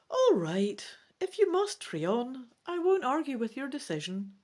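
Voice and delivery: grudging agreement in a character voice; a hint of a young dragon giving in; Defal the dragon.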